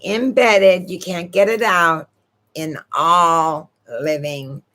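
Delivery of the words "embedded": Embedded repeated with weight; insisting on Embedded; stressing embedded again. Shown as a demonstration. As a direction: hit Embedded a second time, a bit harder. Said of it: "do you hear me?".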